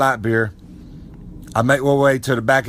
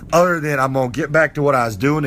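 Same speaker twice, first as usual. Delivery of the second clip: ordinary delivery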